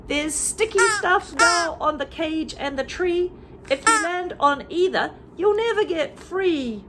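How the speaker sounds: high sing-song bird voice, almost crying at the end; high, pleading bird chant; roly-poly bird warning. Acted. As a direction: warn the flock in a high bird voice. Roly-poly bird warns the birds.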